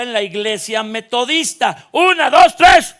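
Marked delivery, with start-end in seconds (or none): 1.9-2.9 shouted count, climbing higher and louder; rally the congregation with a big count. Real, not acted. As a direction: call out the count to cue the crowd. Counting off a loud group shout.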